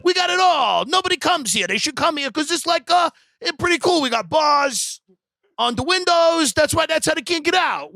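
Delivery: happy guard. Retold or imitated. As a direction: crow it like a proud tour guide. Imitating the happy guard again.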